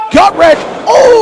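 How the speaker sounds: excited high shout of a ring call; wrestling commentator. Real, not acted. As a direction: yell the big move live. Big move in the ring.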